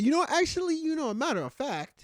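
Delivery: mock hesitance in a high-pitched voice; high, tentative, hedging impression; hesitant imitation. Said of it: "Very hesitantly, he said".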